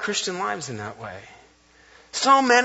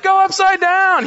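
much higher and louder slow shout; kid yelling; 14 semitones higher, 8 dB louder, slower than usual. Retold or imitated. Boy at camp yelling at the girl on the zip line, loud shout. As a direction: holler it across the camp. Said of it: "He's like".

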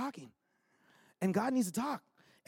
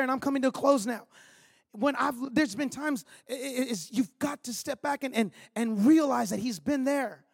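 ordinary delivery

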